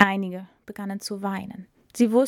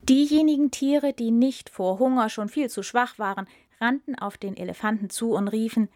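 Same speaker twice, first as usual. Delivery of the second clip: ordinary delivery, nothing marked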